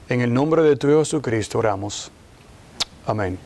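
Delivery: low, subdued praying voice; prayer. Acted. Leads the opening prayer. (oración en voz baja y grave)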